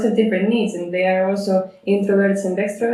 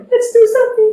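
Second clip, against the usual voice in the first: very high, loud, excitable squeal; eager dog voice; 7 dB louder, much faster than usual. Retold or imitated. Trainer imitating a tireless dog to the host, eager squeal. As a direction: squeak it like a dog bursting with energy.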